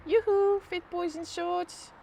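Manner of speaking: high yoo-hoo, slow teasing catcall; flirty call.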